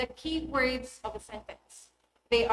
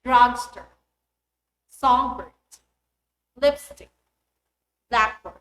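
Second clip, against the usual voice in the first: Drugstore, songbird, lipstick, blackboard, each first part hit louder; first-component stress; 8 dB louder than usual. Shown as a demonstration. Teacher modelling compound noun stress, first part stressed. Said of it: "primary stress is on the first component".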